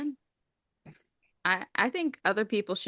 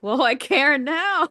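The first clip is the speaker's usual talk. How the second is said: suddenly invested; 8 semitones higher, 6 dB louder, slower than usual. Retold or imitated. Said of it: "and it's like".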